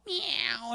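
high cat meow. Acted. Cat luring mouse.

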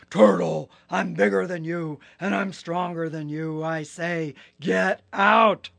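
loud, heavy hippo threat; bullying Hippo. Acted. Hippo bragging he is stronger, loud and bullying. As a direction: throw your weight around, booming.

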